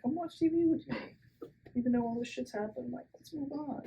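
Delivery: high, breezy mock of the careless husband. Adolfo inviting Lola to watch TV, high breezy mock. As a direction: brush it all off like nothing happened. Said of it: "He's like".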